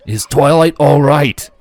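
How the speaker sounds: loud, slow, urgent blurt. Acted. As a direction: burst out with worry. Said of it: "Shining blurted".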